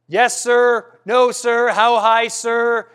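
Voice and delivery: lightly voiced obedient servant; mock servile soldier. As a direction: parrot a drilled servant. Mocking servile replies.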